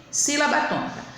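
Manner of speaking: ordinary delivery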